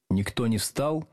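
usual speaking voice

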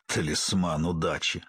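low, slow mocking echo; scornful snort. A narrator's reading. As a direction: repeat it with drawling scorn. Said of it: "фыркнул сидевший напротив Ян Чарут".

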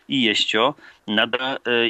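normal talking voice

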